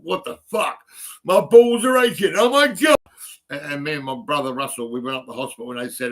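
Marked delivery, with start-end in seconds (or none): 0.0-3.0 high, loud shout of shock and pain; retold pained shout. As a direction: yell it out like the ache just hit. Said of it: "and I thought".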